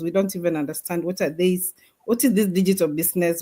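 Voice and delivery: normal talking voice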